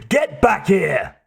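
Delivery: slow, high-pitched angry order; shout. A narrator's reading. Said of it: "the driver shouted".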